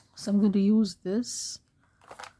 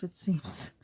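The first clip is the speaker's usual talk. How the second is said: real hoarse, failing voice trailing off; losing voice; 3 semitones lower, 8 dB quieter than usual. Real, not acted. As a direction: voice fading to a breathless whisper. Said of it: "I'm losing my voice".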